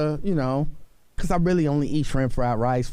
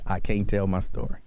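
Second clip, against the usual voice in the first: low mock whine; a lightly voiced mocking quote; mocking her line; 9 semitones lower than usual.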